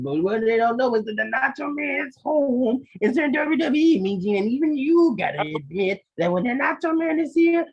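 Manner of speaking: hammed-up promo climbing high into near shouting; Nacho Man. Wrestler bragging to Mean Gene about rivals, Nacho Man promo voice. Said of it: "that was Nacho Man, I'm a Savage".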